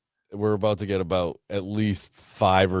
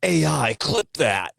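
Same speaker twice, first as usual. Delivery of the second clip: mock command to AI; 7 semitones higher, slower than usual. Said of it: "and then you go".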